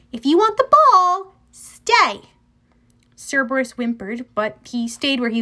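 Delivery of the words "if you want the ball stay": high, loud, firm dog command; ordered. A narrator's reading. Annabeth orders Cerberus to wait for the ball, firmly. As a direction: bargain loudly, then command. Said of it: "Annabeth ordered the monster".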